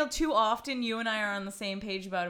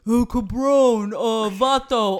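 put-on loud, drawn-out Mexican-accented shouting; coked-up Mexican guy; 12 dB louder, slower than usual. Podcaster auditioning for a movie voiceover, shouting coked-up Mexican guy. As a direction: shout Spanish slang like a hammed-up Mexican character. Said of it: "I'll do the coked up Mexican guy".

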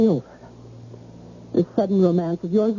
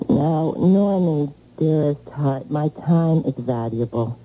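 sultry purr; 7 semitones lower than usual. Acted. Seductive woman charging by hour.

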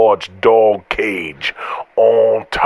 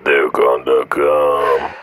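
low call-center voice; 7 semitones lower, slower than usual. Acted. Answering a phone call skit.

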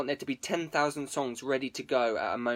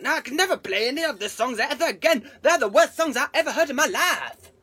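high, loud whiny voice with a laugh; mock fed-up songwriter; 10 semitones higher, 5 dB louder than usual. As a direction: ham up a songwriter hating his own songs. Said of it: "It's like".

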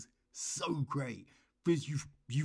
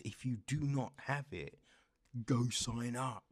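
ordinary talk

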